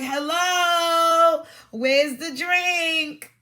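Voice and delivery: loud, high mock shout, stretching out the drink; hammed-up indignant yell at the empty glass; dramatic mock outrage. Mocking a woman demanding a refill from her date, hammed-up shout. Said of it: "but I'm being dramatic".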